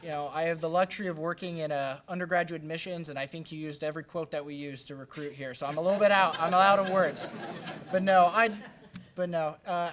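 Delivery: laughing loudly through nervous words. Real, not acted.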